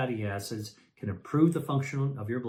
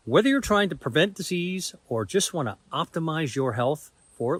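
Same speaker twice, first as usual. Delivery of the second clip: higher-pitched, punchy ad read with emphatic key words; promo read; 4 semitones higher, 4 dB louder than usual. Acted. Doctor pitching his online course to viewers, emphatic promo read.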